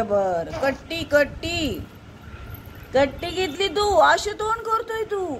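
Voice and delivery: put-on baby talk. Grandmother coaxing a sulking baby, sing-song baby talk.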